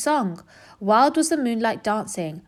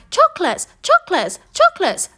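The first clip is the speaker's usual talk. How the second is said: far higher, louder echoing chocolates; cave voice; 6 dB louder than usual. A narrator's reading. Voice from the cave answering the rat, high echo. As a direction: squeal it back like an eerie echo. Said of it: "the voice from the cave replied".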